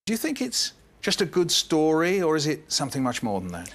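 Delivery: normal talking voice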